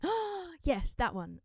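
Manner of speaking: high gasp of recognition falling away; sudden realisation. Retold or imitated. Singer recalling an old request, mock sudden realisation. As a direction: act out a sudden, pleased recollection. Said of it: "my brain kinda went".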